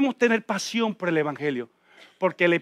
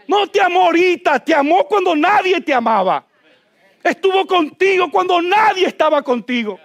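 higher, louder fervent preacher shouting; impassioned preaching; 5 semitones higher, 4 dB louder than usual. Real, not acted. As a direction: preach it at full force, building to shouts. Preaching climax. (predicación apasionada a gritos)

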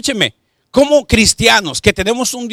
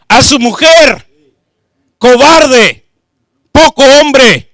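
loud, fervent sermon delivery; preaching at full force; 5 semitones higher, 8 dB louder than usual. Acted. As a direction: preach it out to the congregation.

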